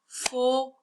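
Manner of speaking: Fauteuil broken as fau, long pause, teuil; split into syllables. Shown as a demonstration. Teacher counting syllables for pupils, Fauteuil split in two. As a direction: sound out Fauteuil one syllable at a time. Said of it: "On va taper pour savoir il y a combien de syllabes".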